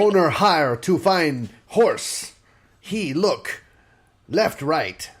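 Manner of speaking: high, loud, slow broken caveman talk. Retold or imitated. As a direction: grunt it out like a caveman. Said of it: "talking like a caveman".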